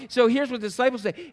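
ordinary talk

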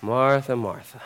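low, hushed name-calling; gentle calming. Shown as a demonstration. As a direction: soothe someone worked up, softly and low. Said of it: "just like we would say it now".

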